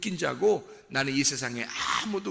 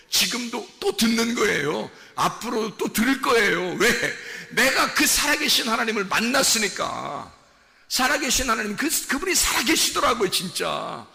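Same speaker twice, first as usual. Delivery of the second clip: raised, high-pitched preacher's testimony; impassioned preaching; 7 semitones higher, 6 dB louder than usual. Real, not acted. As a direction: preach it out with full conviction. Preaches at full force. (열정적으로 외치는 설교)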